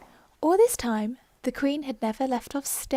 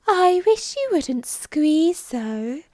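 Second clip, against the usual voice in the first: Dormouse; 6 semitones higher, 7 dB louder, much slower than usual. Acted. Dormouse complaining to Alice.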